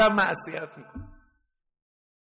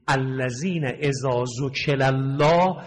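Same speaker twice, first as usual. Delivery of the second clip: Quranic recitation; 3 semitones lower, slower than usual. Acted. Quran verse recited in sermon.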